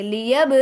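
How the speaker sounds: لیب with the ب shaken and bounced; qalqala on ba. Shown as a demonstration. Teacher demonstrating qalqala on لیب to students. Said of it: "آواز کو اس طریقے سے ہلائیں گے".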